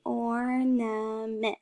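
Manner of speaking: "ornament" slowly as or, na, ment; each syllable of "ornament" held in turn; counted-out syllables. Shown as a demonstration. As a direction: draw "ornament" out in three beats. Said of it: "if you do it on your fingers".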